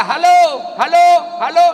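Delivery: loud, high hallo calls; retold phone shouting. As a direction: shout into the wrong end of the phone. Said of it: "Teriaklah dia". (teriakan halo yang keras)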